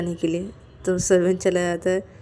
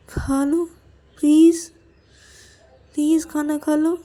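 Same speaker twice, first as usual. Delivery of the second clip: pleading apology; 6 semitones higher than usual. Acted. Asks Payal to forgive and eat, imploring. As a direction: implore her, climbing higher at the end.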